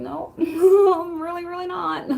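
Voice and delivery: retold denial, pitched high; amused denial. Streamer retelling their reply about the accent, amused high-pitched denial. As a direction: protest in a high voice with a laugh, drawn out slowly.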